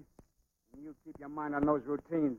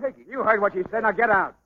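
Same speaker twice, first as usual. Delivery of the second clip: loud, raised dismissal; angry order; 6 semitones higher, 6 dB louder than usual. Acted. Partner ordering the boxer out, loud and angry. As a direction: throw him out with your voice.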